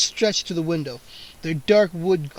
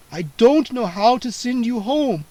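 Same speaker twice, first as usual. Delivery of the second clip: higher, lightly voiced vampire; repeating vampire; 6 semitones higher, 4 dB louder than usual. A narrator's reading. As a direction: say it plainly again. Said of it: "Count Nightwing repeated".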